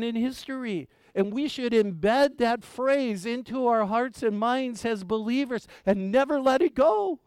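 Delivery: loud, high impassioned preaching; preaching at full force. Acted. Pastor urging the church to hold the phrase, fervent preaching. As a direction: proclaim it like a revival preacher.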